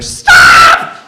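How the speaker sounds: sudden loud low shout; yelled stop. Retold or imitated. Voice outside the cab yelling to stop. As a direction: bark the word like an alarm.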